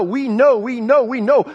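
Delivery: insistent beat on every "know"; stress on know. Shown as a demonstration. Preacher emphasizing one word to the congregation, "know" stressed. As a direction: repeat it, punching "know" each time. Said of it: "I want to emphasize the, the word know".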